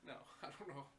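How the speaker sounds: ordinary delivery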